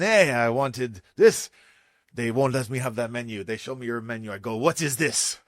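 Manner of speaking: put-on French accent, indignant diner. French diner complaining about the menu to the chef, French accent. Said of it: "Sorry if my French accent gets you here".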